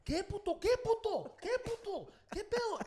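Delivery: very high, repeated taunt; loud, squeaky bravado; mock challenge. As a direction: imitate a beaten fighter still talking tough. Acting the beaten man's challenge.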